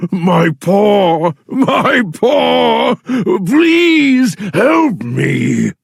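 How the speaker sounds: high crying shout for help; pained pleading shout. Acted. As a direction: beg loudly, hurting. Lion begging in pain.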